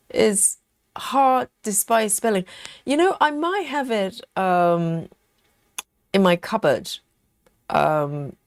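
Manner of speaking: normal talking voice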